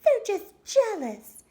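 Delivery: very high, loud scoffing mum; dismissive mother. A narrator's reading. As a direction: wave it away as a brisk mother. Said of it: "said her mother".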